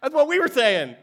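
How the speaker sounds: high, loud, eager agreement from the men; eager soldiers. Retold or imitated. Preacher voicing David's men, eagerly agreeing, high and loud. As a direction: cheer along like excited soldiers. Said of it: "They're like".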